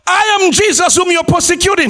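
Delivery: high, declarative voice from heaven; Jesus. Acted. Preacher acting the Lord answering Saul, proclaimed high. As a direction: proclaim the identity with authority.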